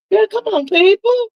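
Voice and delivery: high, pleading exasperation; exasperated plea. Real, not acted. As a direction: throw up your hands at the crowd. Exasperated call to viewers.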